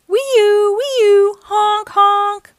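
high, sustained siren wail, then honks; imitated siren and horn. A narrator's reading. Fire truck races off to a fire, loud siren imitation.

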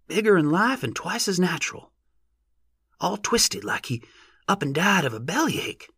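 high-pitched rustic bystander; rustic onlooker. A narrator's reading. Onlooker describing the twisted giant, high rustic voice.

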